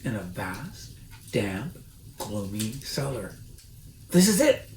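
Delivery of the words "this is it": higher, louder excited call; excited cry. A narrator's reading. Mister Fox thrilled to find the cellar. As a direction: announce the find with glee. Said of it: "cried Mister Fox".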